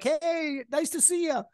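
very high, bubbly, folksy hello; joyful bus-stop greeting, high and light; friendly Chicagoan. Retold or imitated.

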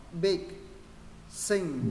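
"Big", "Sing" said distinctly and neatly; clear pronunciation. Shown as a demonstration. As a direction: model "Big", "Sing" as clear single words. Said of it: "bây giờ phát âm rõ ràng". (đọc "Big", "Sing" rõ ràng, gọn)

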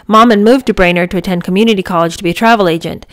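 ordinary delivery, nothing marked

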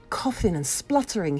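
plain reading voice

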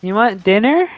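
lightly voiced mom calling with a question; imitated mom. Playing the raging gamer's mom, mock mom voice. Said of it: "Saying that to his mom".

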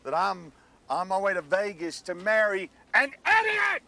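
rising into a loud angry shout; angry yell. Acted. Fiancé blowing up at his bride on the road to Vegas, shouting. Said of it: "a man who yells at me".

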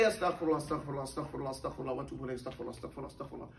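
mock rote repetition. Teacher mocking someone repeating it by rote, mumbled softly. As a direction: rattle it off mindlessly, fading out. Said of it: "you sit down someplace and just say".

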